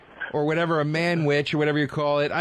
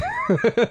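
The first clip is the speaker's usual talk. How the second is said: burst of high laughter. Real, not acted. Laughs after own threat.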